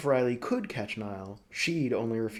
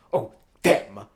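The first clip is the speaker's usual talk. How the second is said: contemptuous; 2 semitones lower, 11 dB louder than usual. Acted. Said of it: "Broly was contemptuous".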